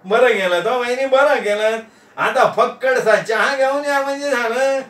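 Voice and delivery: high-pitched jolly guest; cheeky, eager old friend; gleeful Nana. Acted. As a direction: rejoice in a high voice with a chuckle.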